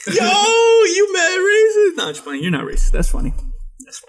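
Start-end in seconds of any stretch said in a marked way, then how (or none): 0.1-2.0 high, slow, fake-shocked question; mock outrage. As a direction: pretend to be offended, drawn out up high. S0 fakes outrage, then "just playing.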